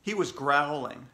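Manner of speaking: plain reading voice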